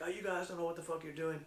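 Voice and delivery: imitated sore loser's complaint; mock pissed-off pro. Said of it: "he was fucking super pissed".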